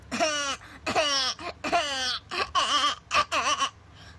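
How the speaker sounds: put-on high wobbly shaky baby cry; wobbly infant sobbing. Shown as a demonstration. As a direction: shake the voice in a baby wail. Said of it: "your voice at its normal tone".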